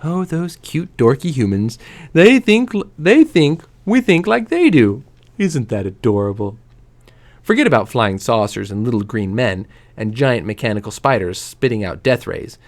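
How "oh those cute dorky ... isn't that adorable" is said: lightly voiced alien overlords cooing over pets; a hint of fond mockery, like cooing at a silly puppy; condescending aliens. Aliens laughing at dorky humans, lightly mock-fond. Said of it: "the way we laugh when a dog does something totally cute".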